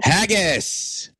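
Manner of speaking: high, drawn-out shout of haggis; excited blurt. Acted. Calling out the answer to a guessing game.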